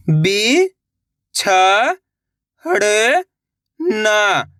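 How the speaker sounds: "बिछड़ना" stretched very slowly by syllable; sounding out slowly. Shown as a demonstration. Teacher sounding out a word for young readers. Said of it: "एक अक्षर का उच्चारण करके".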